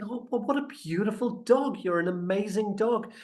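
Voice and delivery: high, cooing praise for a pet; gushing dog praise. Acted. Rep praises the dog fondly.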